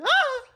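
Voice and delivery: short, very high-pitched mock shriek; imitated child's scream. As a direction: yelp like a frightened little kid. Imitates a scared five-year-old.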